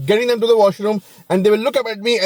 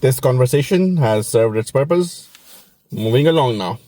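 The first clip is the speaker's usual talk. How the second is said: stiff exit; 8 semitones lower than usual. Retold or imitated. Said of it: "I'm just like".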